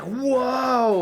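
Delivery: put-on thrilled gasp of a kid; excited whoa. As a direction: burst out with joy. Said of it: "he's really happy and excited".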